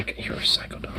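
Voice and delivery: whispered exasperation. Real, not acted. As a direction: whisper it to the dog. Whispering at the dog.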